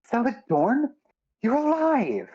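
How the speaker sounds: rising, high-pitched disbelief; softened, amazed. Acted. Riona finds Sella is alive, softened and amazed. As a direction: soften into astonished relief, pitch climbing. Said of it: "Riona softens and goes".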